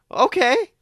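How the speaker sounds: tiny startled yes; meek okay. A narrator's reading. Scientist meekly agrees.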